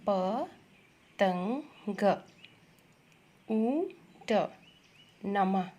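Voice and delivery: drawn-out teacher's word reading; sounded out. Shown as a demonstration. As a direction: read very slowly, piece by piece. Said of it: "यहाँ क्या लिखा हुआ है".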